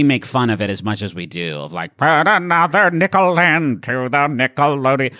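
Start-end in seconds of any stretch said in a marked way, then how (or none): 2.0-5.1 hammed-up old-timey crooner voice, a bit higher and louder; mocking 1920s voice. Mocking old 1920s music in a corny crooner voice. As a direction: send up a tinny 1920s singer. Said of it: "in that fucking voice making fun of it".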